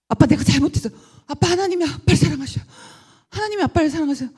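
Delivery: high, loud pleading to her father; a daughter's urgent plea about God's love. Retold or imitated. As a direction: beg your father to hear you.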